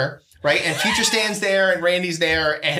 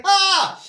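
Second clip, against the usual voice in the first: mock pained scream; 12 semitones higher, 3 dB louder than usual. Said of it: "he's like".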